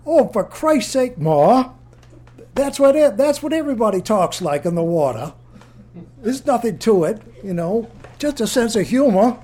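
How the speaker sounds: high, loud exasperated protest; exasperated son. Retold or imitated. Son shouting back at his mother, high and loud. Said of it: "And Chucky said".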